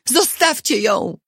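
high-pitched, restrained shout; booming shout. A narrator's reading. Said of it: "krzyknął gromko".

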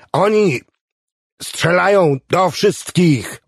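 high, drawn-out heated protest; exasperated retort. A narrator's reading. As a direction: stretch the words out in exasperation. Acted quarrel, Clevenger arguing back.

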